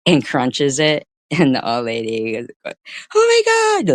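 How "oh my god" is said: much higher, louder shocked shriek; old lady's fit. Retold or imitated. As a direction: shriek it like an outraged old lady. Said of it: "Like, she has a fit".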